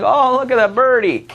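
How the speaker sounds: put-on high, sing-song child spotting a bird; light high voice of an excited kid; cooing kid voice. Teacher voicing the kids spotting a bird, high and cooing.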